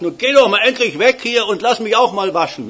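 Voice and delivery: loud, high, impatient demand; retold demand. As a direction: push the other boy away from the basin. Retold quarrel at the washbasin. (laut und ungeduldig)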